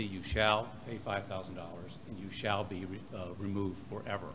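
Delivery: usual speaking voice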